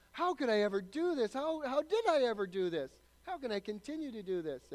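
loud, high, pleading self-reproach; preacher voicing a sinner's anguished lament, loud; anguished sinner's cry. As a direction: cry out as a sinner horrified at himself.

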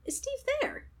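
very high, soft mock phone voice; boyfriend's dad's tone. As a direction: imitate an odd, high-pitched caller. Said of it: "it was his tone that threw me off".

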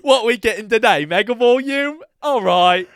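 put-on British-style accent, boisterous salon greeting; British or Kiwi accent. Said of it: "slip in and out of them".

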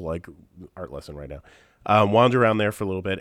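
normal talking voice

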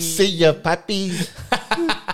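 hammed-up waitress greeting, way up high; mock waitress. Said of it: "Pretend I'm a waitress".

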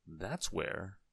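plain reading voice

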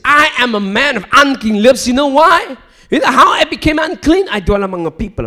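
loud, rising sermon cry; fiery preaching. Acted. Preacher crying out to the congregation, fiery. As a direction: preach it out with full force. Voicing the prophet.

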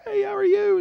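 high, sing-out friendly hello; chirpy parking-lot welcome; friendly greeter. Acted. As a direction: greet a newcomer from across the lot. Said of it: "there's, like, friendly people".

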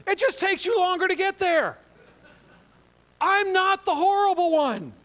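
high, loud, slow, hammed-up triumph; gleeful vindication. Gleefully telling wife she's caught up.

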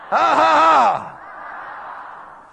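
slow booming preacher laugh; big laugh. Acted. Preacher laughs loudly at Sarah's story.

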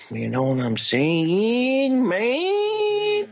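very slow, climbing into a high squeal; goofy drawn-out rise to falsetto; clowning drawl. Pretend. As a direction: stretch it out, sliding way up at the end. Clowning after a sincere answer.

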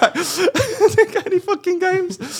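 slow, high, laughing complaint. Real, not acted. Telling friends about the missing games, laughing.

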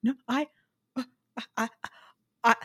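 stammering, lightly mock confused; flustered Brendan. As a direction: play him tongue-tied by a new feeling. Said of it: "And Brendan is like".

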